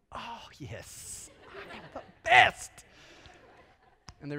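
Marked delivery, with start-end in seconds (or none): 0.1-2.7 laughing, smug self-praise; laughing mock pride. Dad playfully agreeing with his son's praise while laughing. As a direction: gloat playfully through a laugh. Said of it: "I went".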